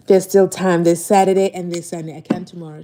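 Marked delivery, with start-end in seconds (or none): none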